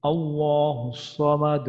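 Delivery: slow chanted recitation ending with echoed qalqalah; Quranic recitation. Shown as a demonstration. Teacher reciting the second verse. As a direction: intone it like tilawat.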